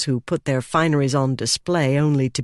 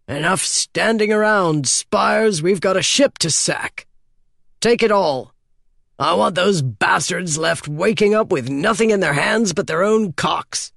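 theatrical rallying speech, pitched up; raised-voice captain's orders; booming captain; 4 semitones higher than usual. A narrator's reading. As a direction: rouse the crew with relish. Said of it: "boomed Alucard".

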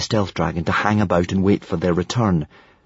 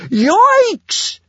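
very high, loud whoop; piercing excited yell; 5 dB louder than usual. A narrator's reading. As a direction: shriek it as you take off. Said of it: "yelled Kamikaze".

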